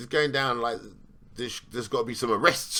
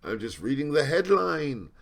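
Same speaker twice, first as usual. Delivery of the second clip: higher, put-on innocent protest voice; mock innocent; 4 semitones higher than usual. Mocking critics who'd call him biased, in a falsely innocent voice. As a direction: play the wide-eyed innocent. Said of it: "I'm not saying".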